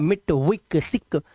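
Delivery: मिट, विक, सिक with short "i"; wrong short vowels. Shown as a demonstration. Teacher demonstrating the misreading, short vowels in मिट, विक, सिक. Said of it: "अब इसे मिट नहीं पढ़ना है".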